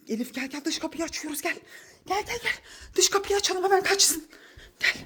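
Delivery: very hushed, hurried call to come; hushed whisper. Real, not acted. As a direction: whisper it so the thief won't hear. Sneaking to open the door.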